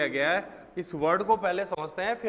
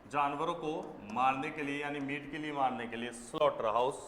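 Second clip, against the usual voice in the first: ordinary talk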